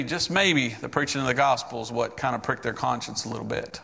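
ordinary delivery

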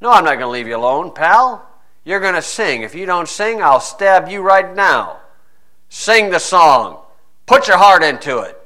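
loud, menacing demand with a blade at his throat; threatening soldier. Retold or imitated. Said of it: "Leave me alone".

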